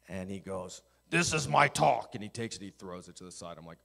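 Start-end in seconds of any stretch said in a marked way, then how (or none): 1.1-2.1 mock-angry preacher, slow and loud. Angry chancellor addressing seminary chapel, acted loud anger. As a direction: announce it like a furious old preacher. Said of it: "He's angry".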